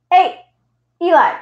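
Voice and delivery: short, loud, high-pitched call. Real, not acted. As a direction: call the pet to attention. Calls sharply to the cat.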